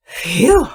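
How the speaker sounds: high, loud whew of relief; relieved "phew". Retold or imitated. Acts out her relief at reply.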